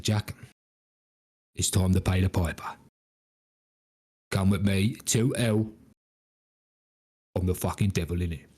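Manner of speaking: low Cockney devil; English accent. Acted. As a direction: claim a soul in a put-on English accent. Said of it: "our evil folk have English accents".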